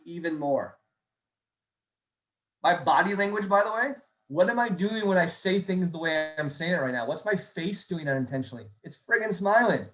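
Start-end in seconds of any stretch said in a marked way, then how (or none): none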